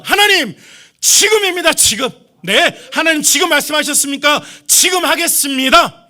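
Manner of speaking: eager prayer. Retold or imitated. 진짜 믿는 사람이 하나님께 즉시 순종하겠다고 외치는, 들뜬 목소리. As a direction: cry out to God that you will do it now. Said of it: "진짜 믿음은 뭐냐면".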